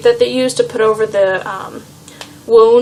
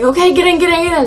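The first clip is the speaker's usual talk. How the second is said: higher-pitched, hurried repeats, retold; urgent urging; 7 semitones higher, faster than usual. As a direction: imitate someone urging a passenger along. Driver urging her into car.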